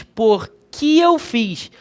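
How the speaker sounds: slow demo, "que" said as ki; slowed, quiet demo of que said ki; E sounding like I. Shown as a demonstration. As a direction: draw out "Por que eu fiz?" with que sounding ki. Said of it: "Esse E tem som de I".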